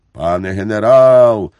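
loud, weighty address; very serious. A narrator's reading. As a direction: call out gravely and firmly. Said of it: "раптом сказав дуже серйозно". (дуже серйозно, гучно)

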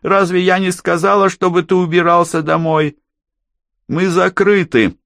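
high, loud scolding woman's voice; hoarse angry woman. A narrator's reading. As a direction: snap it out shrilly as an irate barmaid. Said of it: "раздался хриплый женский голос". (хриплый сердитый женский голос)

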